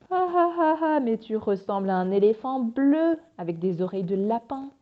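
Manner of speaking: loud fake laugh into a jeer; hammed-up ridicule, starting high and loud; mocking taunt. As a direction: laugh in their face, then taunt loudly. Acting out someone jeering absurdly.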